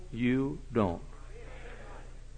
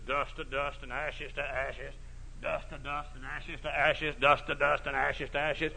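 soft, dead-man's mock funeral intonation; cemetery talk; 8 dB quieter than usual. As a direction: drone it like a dead man at the grave. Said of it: "that's cemetery talk".

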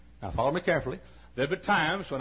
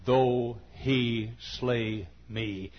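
very slow, low, fading Job quote; solemn scripture; 6 semitones lower, slower than usual. Real, not acted. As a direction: lay the verse down reverently.

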